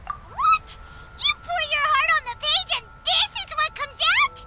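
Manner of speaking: incredulous outrage. Acted. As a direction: leap up in pitch with disbelief. Incredulous at the painter's answer.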